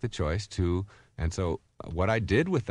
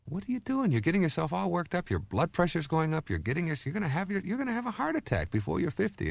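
much higher, earnest scolding of a waiter; high pitched scolding; 9 semitones higher than usual. Retold or imitated. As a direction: retell your worried lecture up high. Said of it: "and I said".

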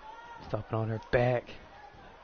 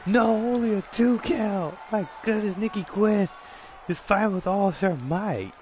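high, excited call, slow and drawn out; wrestling commentator; 6 semitones higher, slower than usual. Acted. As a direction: hype the near fall like a ringside announcer. Live play-by-play excitement.

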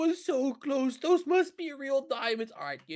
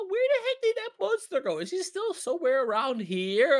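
usual speaking voice

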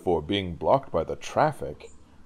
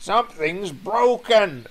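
high, strained words between coughs; coughing through steam; 13 semitones higher, 4 dB louder, slower than usual. Acted. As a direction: splutter it out slowly through billowing steam. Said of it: "the driver coughed through the billowing steam".

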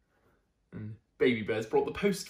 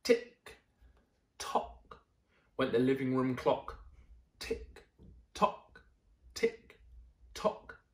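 crisp, clipped tick-tock; clock ticking; slower than usual. Acted. Narrator voicing the living room clock, crisp ticks. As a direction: tap out the clock's beat.

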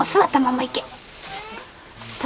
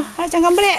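raised, high cheer; 6 semitones higher than usual. Real, not acted. Urging Haru-chan on through the birth, cheering. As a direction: call out encouragement, pitched up.

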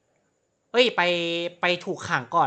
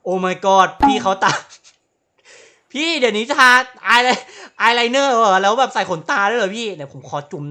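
louder and higher, slowed gasping surprise; shocked tease; 4 semitones higher, 10 dB louder than usual. Real, not acted. Gamer mocks cop's eyeliner, loud shocked teasing. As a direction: gasp at the makeup.

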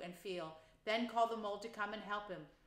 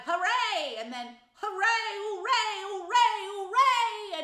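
much higher, much louder joyful cheers; cried hooray; 12 semitones higher, 13 dB louder, slower than usual. A narrator's reading. Rat cheers his find in the snow, shouting. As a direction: shout each hooray with glee. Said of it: "Suddenly the rat cried".

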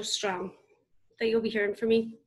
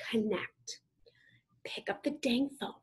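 hushed, breathy word of advice; whispered urging; 7 dB quieter than usual. Real, not acted. Speaker urging the audience to reach out, whispered. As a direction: whisper it like a secret tip.

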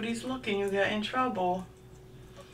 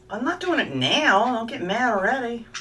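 louder and higher over the squawking; louder, higher voice telling the bird not to get mad; raised voice; 7 semitones higher, 9 dB louder than usual. Real, not acted. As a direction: call it out over the noisy bird. Bird squawks about nail trim.

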